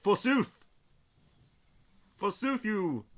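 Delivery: mock Shakespearean forsooth, pitched high; hammy stage actor. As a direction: ham it up like an old stage actor. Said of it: "They're gonna do the".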